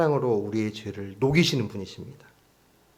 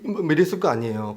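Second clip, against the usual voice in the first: ordinary delivery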